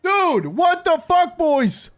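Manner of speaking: high, slow, drawn-out disbelief; real stunned yell; stunned shout. Real, not acted. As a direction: stretch it out, overwhelmed. Reacting to more gifts.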